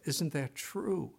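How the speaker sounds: normal talking voice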